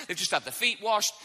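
ordinary delivery

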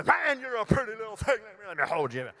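put-on high, forceful burst at a baby; off-putting tone. Shown as a demonstration. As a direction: come on far too strong to a stranger's baby. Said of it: "respond to the tone of your voice".

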